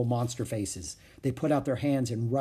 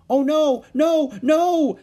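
very high, loud protest; alarmed shout; 11 dB louder, much slower than usual. A narrator's reading. Annie to the oncoming wildebeest, alarmed shout. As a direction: shout repeatedly in panic. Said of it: "shouted Annie".

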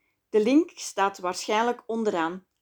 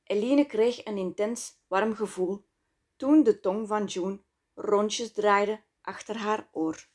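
ordinary delivery, nothing marked

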